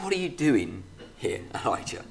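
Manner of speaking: soft, tender question from a caring father; loving father. Acted. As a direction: ask gently like a loving father. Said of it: "loving, caring father heart of God".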